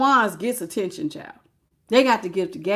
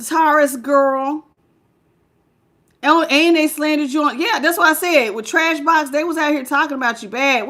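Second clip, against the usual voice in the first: ordinary talk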